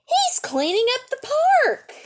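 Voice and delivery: high thrilled announcement; excited call. Acted. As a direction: point it out to everyone, delighted. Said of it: "shouted Charlie".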